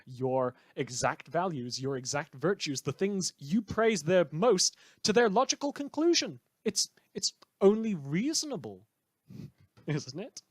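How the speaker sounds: smug, theatrical broadcaster sneering at his listeners; villainous radio host. Acted. A rogue radio host taunts his listeners about killing, in a smug on-air voice.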